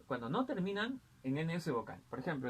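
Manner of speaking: usual speaking voice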